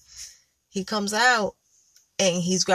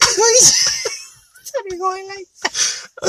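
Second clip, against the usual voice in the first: loud, high burst of laughter; laughing hard; 13 semitones higher, 4 dB louder, much slower than usual. Real, not acted. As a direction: laugh out the words. Breaks into laughter.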